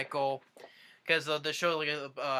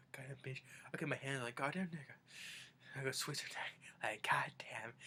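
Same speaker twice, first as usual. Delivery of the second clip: whispering; 11 dB quieter than usual. Retold or imitated. Imitating Grandpa muttering.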